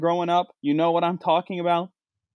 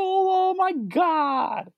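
mock gasp of awe; 11 semitones higher, much slower than usual. Mock awe at castle light show.

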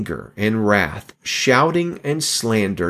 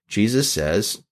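normal talking voice

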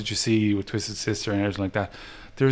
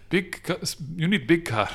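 hammed-up Swedish accent, pitched up; 7 semitones higher than usual. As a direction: imitate the Swedish singer's accent, higher. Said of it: "I'm doing a bit of disservice to his accent".

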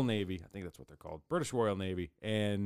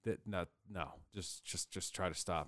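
retold weary plea; 4 semitones lower, 7 dB quieter, slower than usual. Retold Brits begging them to stop.

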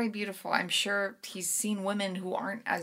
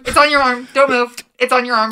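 urgent warning; 2 semitones higher, 15 dB louder than usual. Acted. Warning about bee on arm.

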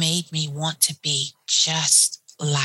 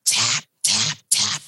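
imitated tapping; 7 semitones lower, slower than usual. Imitating foot tapping noise.

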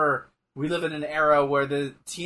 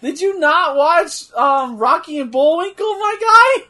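much higher and louder, slow disbelief; can't believe they missed it; incredulous outburst; 12 semitones higher, 9 dB louder than usual. Real, not acted. Teasing friend, incredulous.

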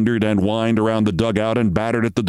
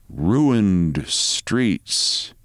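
plain reading voice

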